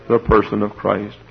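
ordinary talk, nothing marked

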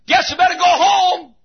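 high-pitched shout of giving in; acted high-pitched outcry; shouting back. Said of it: "Shallow shouts back and says".

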